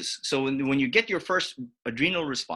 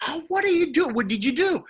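high alarmed protest; a hammed-up startled friend shouting; mock alarmed cry; 13 semitones higher than usual. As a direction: cry out in shock. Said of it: "he screamed".

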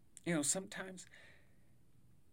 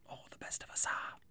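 whispering; 4 dB quieter than usual. Acted. Whispered aside.

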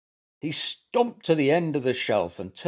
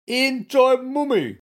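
high, slow, loud lament; sighing self-pity; 13 semitones higher, 6 dB louder than usual. A narrator's reading. Bear pretends tummy ache, high drawn-out sigh. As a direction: draw it out pitifully, pitched high. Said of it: "sighed Goompa".

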